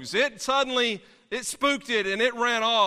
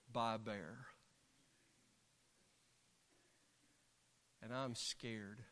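frightened whispered prayer; 7 semitones lower, 14 dB quieter than usual. Retold or imitated. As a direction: pray quietly to God, afraid of the bear. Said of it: "And this is what I prayed".

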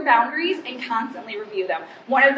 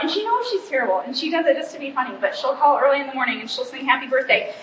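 normal talking voice

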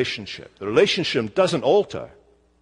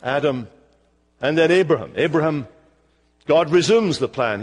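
ordinary delivery

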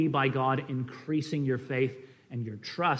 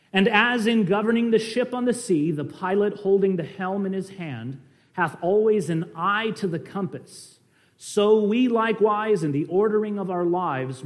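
higher, louder sermon reading; emphatic quote reading; 5 semitones higher, 5 dB louder than usual. A narrator's reading. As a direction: read the old quotation out to the congregation. Reads a Perkins quotation aloud.

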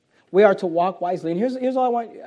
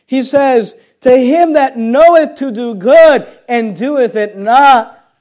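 slow, loud, raised scripture proclamation; emphatic preaching; 5 semitones higher, 11 dB louder, slower than usual. Real, not acted. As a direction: thunder the verse out word by word. Preaches the verse at force.

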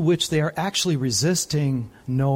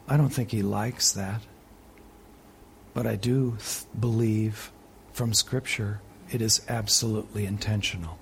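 quiet sermon; 6 semitones lower, 9 dB quieter than usual. Real, not acted. Quiet intimate preaching stretch.

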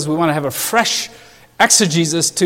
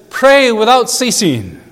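louder, higher, weight on Pray; staccato command; 6 semitones higher, 4 dB louder than usual. Shown as a demonstration. Preacher quoting Paul's brief commands, Pray punched loud. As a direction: hit "Pray" hard and high, then clip "without ceasing". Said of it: "all of these staccato commands".

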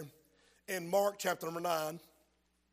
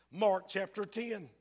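ordinary talk

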